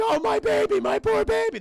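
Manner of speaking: high, loud hammed-up mother's wail; mock wailing mom. Mom over her dying son, hammed-up high wail. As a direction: overplay a grieving mom. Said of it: "mom's like".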